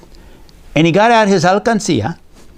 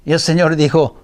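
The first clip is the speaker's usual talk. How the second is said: usual speaking voice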